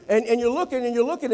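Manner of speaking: ordinary delivery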